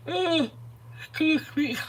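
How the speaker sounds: real yawn over a quick apology. Real, not acted. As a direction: excuse me pulled up high through a yawn. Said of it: "I don't know why I'm yawning so much".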